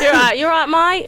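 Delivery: brisk Cockney greeting; Cockney accent. Pretend. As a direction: hail a mate in put-on Cockney.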